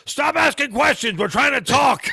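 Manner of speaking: high, loud exasperated shout; imitating an annoyed man yelling; mock yelling. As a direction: holler it as a hammed-up tirade. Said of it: "He starts yelling at him".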